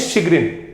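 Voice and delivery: teaching शिगरिन as shi-grin; correct pronunciation. Shown as a demonstration. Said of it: "ये चैगरिन नहीं है बेटा, इसे बोलते हैं".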